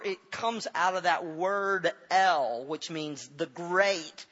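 loud, high, slow pulpit proclamation; a preacher raising his voice over the crowd; full-force preaching. Acted. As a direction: preach it out to the whole congregation.

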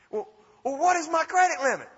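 high-pitched eager question; acted-out excited caller; amazed. Acts his amazed question on phone.

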